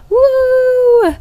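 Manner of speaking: high, loud whoop of joy; excited cheer. Real, not acted. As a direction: cheer with put-on energy. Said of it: "pretending like I have so much energy".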